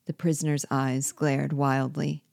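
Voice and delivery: usual speaking voice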